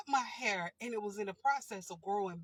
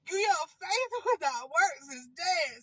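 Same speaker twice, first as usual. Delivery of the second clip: laughing shout; 5 semitones higher, 7 dB louder than usual. Real, not acted. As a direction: shout the punchline through laughter, louder. Laughing punchline loud.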